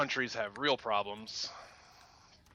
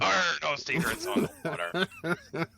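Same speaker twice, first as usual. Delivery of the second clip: laughing mock pirate accent; 5 semitones higher than usual. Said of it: "This is almost pirate".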